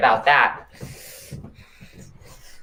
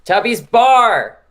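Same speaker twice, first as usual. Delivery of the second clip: excited shout; 9 semitones higher, 7 dB louder, much slower than usual. Real, not acted. Hype shout about a bar.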